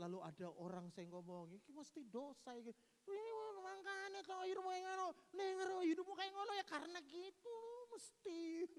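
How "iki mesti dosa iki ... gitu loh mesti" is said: high, sneering Javanese blame; mock gossip. Visitor blaming the sick for their sin. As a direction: needle the sick person.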